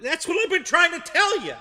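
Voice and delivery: high, loud exasperated counterman; punchline shout. Acted. Counterman finally snapping at the old lady, loud and high.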